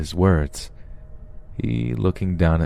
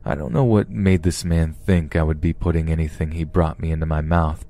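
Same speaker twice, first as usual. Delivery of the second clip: plain reading voice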